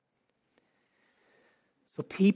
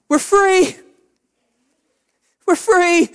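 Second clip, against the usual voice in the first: high, loud cry of relief; joyful Israelites; 10 semitones higher, 5 dB louder, slower than usual. Acted. Freed Israelites celebrating, high excited cry. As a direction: shout it like you just got out of chains.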